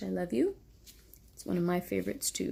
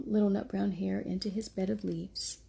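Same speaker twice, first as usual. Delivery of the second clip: ordinary delivery, nothing marked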